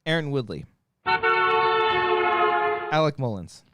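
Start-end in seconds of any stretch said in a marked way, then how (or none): none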